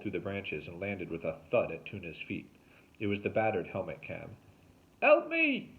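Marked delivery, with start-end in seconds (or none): 5.0-5.7 loud, high-pitched cry; panicked yell with the fake accent dropped; crying for help. A narrator's reading. Derek dangling upside down, crying out for help. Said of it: "cried Derek Badger".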